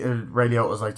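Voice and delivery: usual speaking voice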